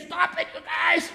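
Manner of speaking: high mock-frightened shriek of a mother; hammed-up plea from the terrified mom; mother scolding. Scared mother on Ferris wheel.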